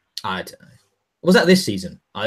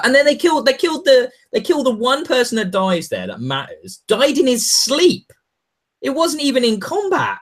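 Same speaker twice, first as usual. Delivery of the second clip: indignant rant; 11 semitones higher than usual. Real, not acted. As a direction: vent the outrage. Outraged rant about character death.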